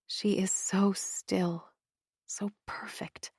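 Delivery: whispered, tender and hushed; soft whisper. A narrator's reading. Mother whispering over her sleeping daughter. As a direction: breathe it over a sleeping child.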